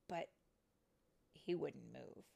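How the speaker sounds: ordinary delivery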